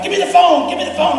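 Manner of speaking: high insistent begging for the phone; imitating an eager wife. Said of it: "my wife's like".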